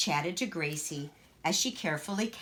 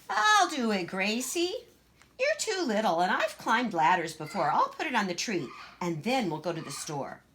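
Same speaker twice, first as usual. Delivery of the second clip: put-on high, bossy little girl voice; Greta, boastful child; 4 semitones higher, 4 dB louder than usual. Big sister boasting to Gracie, high and bossy. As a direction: show off being taller.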